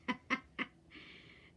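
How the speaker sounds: high burst of real giggling; spontaneous laughter at oneself. Real, not acted. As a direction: break into a quick, breathy cackle of amusement. Laughs at own facial expression.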